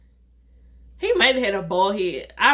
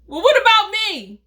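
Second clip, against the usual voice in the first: high, loud mock indignation; indignant protest; 11 semitones higher, 6 dB louder than usual. Date left out of the waiter's offer, a high indignant protest. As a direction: pipe up as if left out. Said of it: "So I'm looking like".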